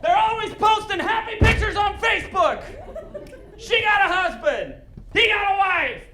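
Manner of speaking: high, loud comic rant with laughter; shrieking mock jealousy; mock outraged shout. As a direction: yell it like the injustice is unbearable. Comic rant about ex's happy life.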